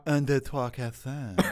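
lisping French accent, tongue hanging out; hammed-up French accent. Shown as a demonstration. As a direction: let the tongue hang out and count in mock French. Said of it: "make sure your tongue kinda hangs out of your mouth".

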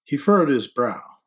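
usual speaking voice

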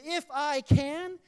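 acted indignant disbelief, drawn out; incredulous. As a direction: throw it back as an astonished question. Jesus' incredulous reply to the dad.

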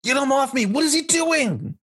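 high-pitched frantic yell; alarmed shout. Acted. Game master as a man being grabbed in a brawl, alarmed shout. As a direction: cry out in outraged panic, very high. Player acts a struggle mid-fight.

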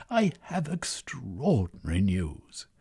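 slow, breathless whisper; out of breath. Acted. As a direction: gasp out the news. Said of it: "he cried as he panted for breath".

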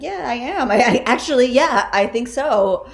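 amused, laughing through the answer. Retold or imitated. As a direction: laugh it off proudly. Laughing reply to relatives.

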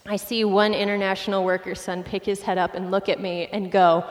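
ordinary talk, nothing marked

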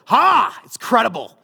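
a loud, high "Ha" of triumph; triumphant gotcha. Retold or imitated. Husband proving a statistic to his wife, triumphant and loud. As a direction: crow it like you just won the argument.

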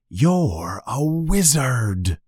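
slow, drawn-out revelation; momentous. A narrator's reading. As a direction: let each word land slowly. Hagrid reveals the big news.

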